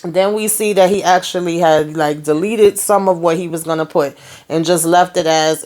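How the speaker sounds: usual speaking voice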